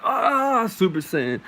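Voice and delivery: a sudden high, loud anime power-up cry; Super Saiyan yell. Retold or imitated. To the listeners, the narrator powering up with a high anime yell. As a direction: scream like you're going Super Saiyan.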